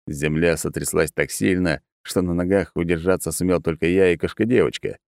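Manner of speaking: ordinary delivery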